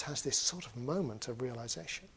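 ordinary delivery

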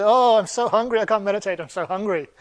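loud, high moan easing off; hammed-up starving complaint; mock hungry wail. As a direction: lament theatrically, starting big. Sage repeats his hungry moan.

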